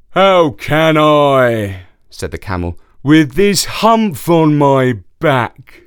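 slow, loud camel complaint; whiny camel voice. Acted. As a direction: whine it out as the camel. Camel whines about its hump.

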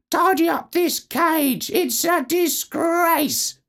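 very high chirpy parakeet. Acted. Famous parakeet asking for a nut, high parakeet voice. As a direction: squeak it like a trained parakeet. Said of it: "taught a parakeet to say things like".

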